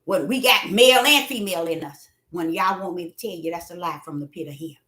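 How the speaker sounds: ordinary talk, nothing marked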